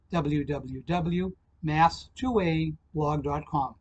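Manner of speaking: ordinary talk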